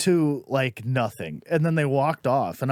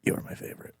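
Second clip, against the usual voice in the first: unvoiced, confiding retold whisper; imitated whisper; 10 dB quieter, much faster than usual. Departing audience woman to the comic, confiding whisper. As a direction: whisper it like a secret.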